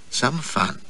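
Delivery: quiet, flat, sardonic repeat of Some fun; dry echo. Acted. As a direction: echo Some fun under the breath, unimpressed. Narrator echoes her words drily.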